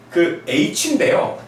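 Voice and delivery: usual speaking voice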